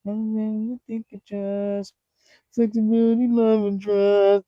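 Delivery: high, slow, far-off cartoon voice; very high drawn-out cartoon singsong; cartoon character voice. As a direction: quote it like a sweet Steven Universe character. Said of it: "if you know Steven Universe".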